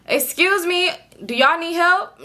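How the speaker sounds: politely wary challenge from the gate; lightly voiced lady. Older lady at the gate questioning two girls, mildly suspicious.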